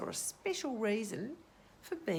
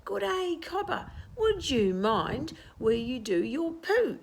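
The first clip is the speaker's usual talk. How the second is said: irritated shout; 6 semitones higher, 6 dB louder than usual. Acted. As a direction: scold him for the mess. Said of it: "shouted an irritated trapdoor spider".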